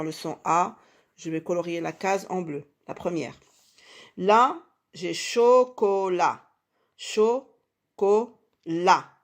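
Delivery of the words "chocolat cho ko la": CHOCOLAT slowly split as cho, ko, la; syllable by syllable. Shown as a demonstration. Teacher locating the A for pupils, syllable by syllable. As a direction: break "chocolat" into slow separate syllables, pausing between. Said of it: "dans la dernière syllabe".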